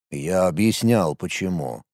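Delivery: low, quiet displeased grumble. A narrator's reading. Young man reminds the narrator he already explained, displeased. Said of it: "недовольно заметил Каналуа".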